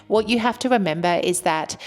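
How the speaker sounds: usual speaking voice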